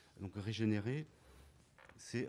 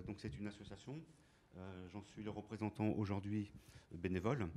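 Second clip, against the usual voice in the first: ordinary talk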